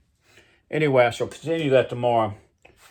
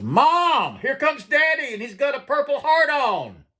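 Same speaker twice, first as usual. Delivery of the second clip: put-on much higher excited child voice; little boy calling. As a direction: squeal it like a kid spotting dad. Young son calling out.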